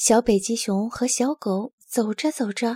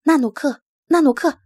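loud, raised calls of the name; calling out; 4 semitones higher, 9 dB louder than usual. Acted. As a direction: shout his name to stop him. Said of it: "拉尔斯喊道".

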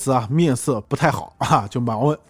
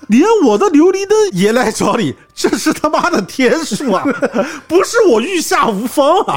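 laughing; 11 semitones higher, 4 dB louder than usual. Acted. Voicing Randeng, laughing.

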